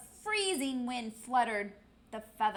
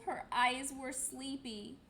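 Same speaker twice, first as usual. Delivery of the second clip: plain reading voice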